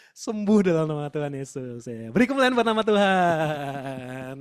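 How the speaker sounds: loud, slow, drawn-out proclamation; exultant praise. Retold or imitated. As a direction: stretch it out like a triumphant shout of praise. Exultant reaction to the healing.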